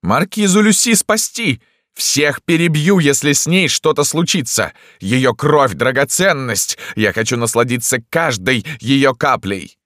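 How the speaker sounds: high-pitched imperious cry; emperor's shout. A narrator's reading. Emperor ordering his people to save wounded Lyuska, shouting. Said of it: "Император крикнул".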